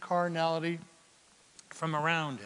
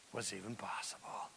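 whisper; 9 semitones lower, 16 dB quieter than usual. Acted. Dropped to a whisper.

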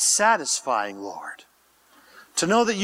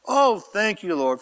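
ordinary talk, nothing marked

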